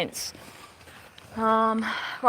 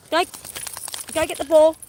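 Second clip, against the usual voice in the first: higher, louder "go get the ball"; urging the dog; 6 semitones higher, 3 dB louder than usual. Real, not acted. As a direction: send the dog off to fetch. Sending the dog to fetch.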